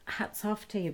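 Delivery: whispered, soft hats off; hushed tribute. Real, not acted. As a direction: breathe it out as a quiet salute. Tribute to people nursing loved ones.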